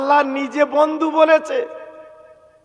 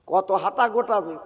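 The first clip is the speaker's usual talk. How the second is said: ordinary talk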